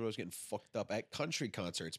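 ordinary delivery

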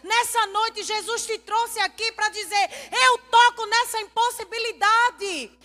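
impassioned preaching. Acted. Pastor to congregation about the impossible, impassioned preaching. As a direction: drive it home to the congregation, ringing out.

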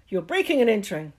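fast, loud, high bluster; loud demand. Acted. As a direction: bark an order. Vernon ordering the giant out.

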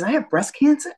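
high, rising note of surprise; retold astonishment, pitch jumping up; disbelieving question. Retold reaction to diagnosis.

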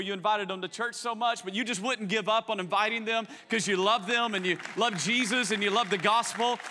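ordinary delivery

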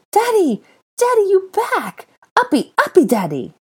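put-on very high, loud little-boy squeals; squealing toddler excitement; delighted child. A narrator's reading. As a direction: beg to be picked up, overjoyed. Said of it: "A scream of delight".

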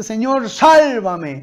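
loud, drawn-out mock shout of a plea; retold cry for help. Sinking Peter to the Lord, retold cry for help. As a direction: cry out slowly, as if calling for rescue. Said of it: "Pedro grita".

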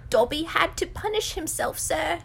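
high, apologetic house elf; high-pitched creature voice. A narrator's reading. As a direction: explain as a humble little elf. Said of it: "in such a high-pitched voice".

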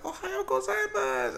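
squeaky little niece; sweet high kid greeting uncle; little girl voice. Retold or imitated.

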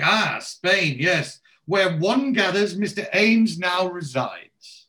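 high, drawn-out, affected formality; haughty posh lady. Retold or imitated. Lady gathering where Mister Ames lives, haughty and slow. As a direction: savour it with lofty knowingness. She's like.

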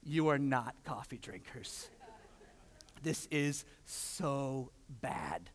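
slow, dragged-out verdict of disgust; half-joking grimace in the voice; mock disgust. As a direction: pronounce the coffee terrible, very slowly. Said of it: "and I was like".

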